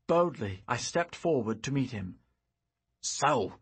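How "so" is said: deep growl. Acted. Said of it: "growled the deep voice".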